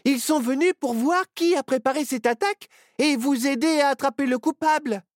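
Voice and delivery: put-on the little girl explaining earnestly; Myrtille's voice. Myrtille reassures the sheriff. (voix aiguë de fillette qui explique sérieusement)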